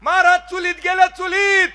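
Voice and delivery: high, loud snapping answer; wife yells back from inside; wife's loud shout. Retold or imitated. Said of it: "बायकोने लय मोठ्याने आवाज दिला".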